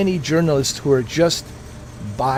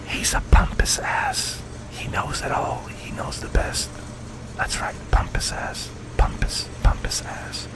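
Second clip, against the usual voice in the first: whisper; 9 dB quieter than usual. Pretend. Mocking whisper about Affleck.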